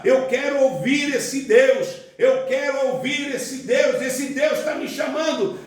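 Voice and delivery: earnest, eager Moses; eager reply. Acted. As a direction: plead to hear God. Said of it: "Moisés então dá a resposta".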